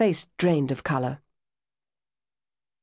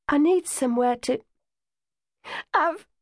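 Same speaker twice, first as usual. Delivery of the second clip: breaking down; 10 semitones higher than usual. Acted. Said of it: "she burst into desolate sobs".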